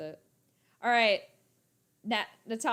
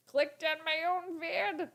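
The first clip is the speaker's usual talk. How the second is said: incredulous self-mockery; 5 semitones higher, slower than usual. Real, not acted. Owning up to a silly mistake.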